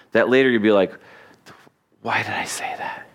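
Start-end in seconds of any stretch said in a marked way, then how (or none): 2.0-3.1 hushed, whispered self-reproach; regretful whisper. Retold or imitated. Speaker replaying a stupid funeral remark to himself, regretful whisper. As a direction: whisper it privately, kicking yourself.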